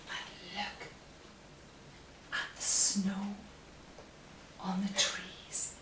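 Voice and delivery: quiet, hushed reading to little ones; hushed picture-book narration; soft storytime reading. Acted. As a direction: read it gently like a bedtime story. Reading story to children softly.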